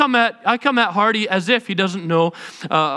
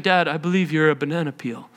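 mock solemn kid; 6 semitones lower, 4 dB quieter than usual. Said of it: "and saying".

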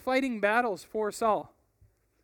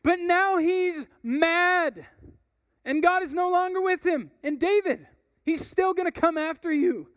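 blazing back; 8 semitones higher, 5 dB louder than usual. Acted. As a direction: fire it straight back at him. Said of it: "Joab blazed back".

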